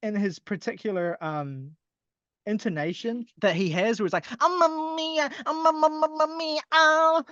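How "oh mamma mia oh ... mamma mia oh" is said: much higher, croaky mock rock-singer wail; mocking singer imitation. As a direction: ham up the singer's warble like a frog in the throat. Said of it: "[name] with a fucking frog in his throat".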